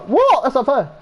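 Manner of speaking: high-pitched mock outrage; indignant protest. Pupil given a month's detention, indignant protest.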